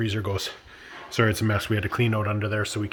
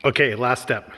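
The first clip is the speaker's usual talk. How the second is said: ordinary delivery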